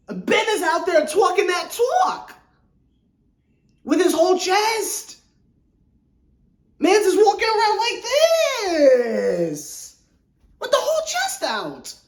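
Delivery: high, hammed-up puffed-chest voice; mock swagger. Host mocking a player talking big, exaggerated swagger.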